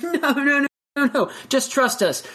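high, insistent coaxing; lightly voiced birds urging a scared friend; pushy birds. Birds urge Chicken to jump, high coaxing. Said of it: "And they're like".

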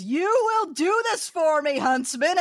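mock shout. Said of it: "at top fucking volume".

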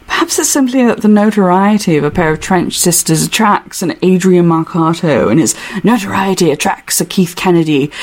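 put-on British accent, reading aloud as Hutch; lightly theatrical English accent. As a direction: read it in a put-on London accent. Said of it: "my Hutch accent is gonna sound like".

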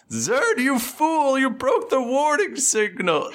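high, slow, drawn-out wail of blame; accusing voice from the dome. Acted. Game master as voice inside the dome blaming Zerd, wailing accusation. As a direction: wail it loud and stretched, like a far-off cry. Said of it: "you hear from, from in front of you".

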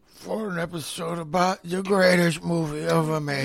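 slow, mumbled mock mobster outro; a light put-on Godfather voice; Brando character voice. Host closing the episode in his Brando character voice. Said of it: "You get so down into that character".

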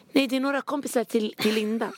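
high, nervous falsetto excuse; scared falsetto. Retold or imitated. Daughter explaining the party to her mother, high and nervous. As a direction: squeak out an innocent excuse. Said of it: "Men jag är falsett för jag är rädd".

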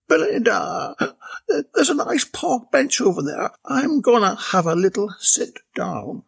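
tired Bella begging her boss for a rest. A narrator's reading. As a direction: plead for a sit down. Bella voiced, out of breath.

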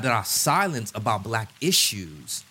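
ordinary talk, nothing marked

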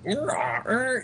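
high, silly imitation of a gorilla roar; mock the lame game noise; mock Donkey Kong roar. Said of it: "makes these horrible noises".

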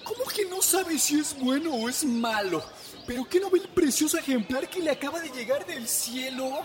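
high, baffled villager voice; incredulous neighbour. Acted. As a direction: scold her in disbelief. Neighbour scolds the farmer, voiced.

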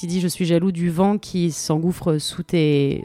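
usual speaking voice

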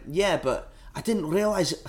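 high, slow attempt at a Scottish accent; failed Edinburgh accent. Voicing the disappointed ticket winner, poor Edinburgh accent. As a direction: stretch it out in a bad Edinburgh accent. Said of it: "Can't do the accent".

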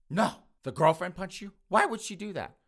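high-pitched scandalized questions; shocked. Acted. Officer hearing the girlfriend punched her, shocked. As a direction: react with exaggerated disbelief.

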